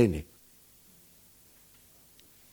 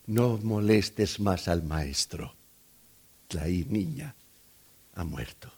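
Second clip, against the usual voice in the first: hushed bad news; 8 semitones lower, 9 dB quieter than usual. Messengers breaking the death to the waiting father, hushed. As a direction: let the death message sink away almost to nothing.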